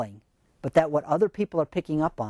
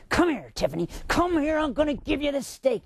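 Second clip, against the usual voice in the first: harsh tone; 7 semitones higher than usual. Shown as a demonstration. Owner holding out a steak to the dog, harsh unwelcoming call. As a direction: unfriendly call that sounds like trouble. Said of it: "she's paying attention to my tone".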